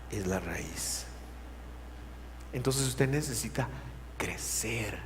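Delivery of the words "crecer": whispered word for emphasis. Real, not acted. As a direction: drop to a whisper on crecer.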